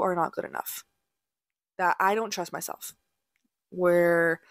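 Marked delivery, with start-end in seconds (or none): 3.7-4.4 burping mid-word; word broken by a burp. Real, not acted. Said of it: "excuse me, I'm burping".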